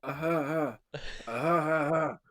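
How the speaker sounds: lightly hammed-up mocking laugh; mock guffaw. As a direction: mimic a passerby laughing at a fall. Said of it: "All you hear is".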